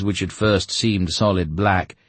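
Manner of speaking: ordinary delivery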